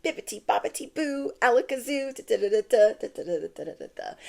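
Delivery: sing-song spell like a fairy godmother. Retold or imitated. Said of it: "fairy godmother comes in and is like".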